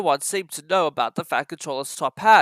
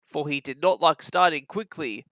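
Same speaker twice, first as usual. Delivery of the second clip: ordinary delivery, nothing marked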